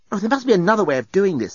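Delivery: quick, high woman's voice; urgent reasoning. A narrator's reading. Nettie interrupting friends' pointless pushing, urgent reasoning. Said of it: "Something about her tone of voice".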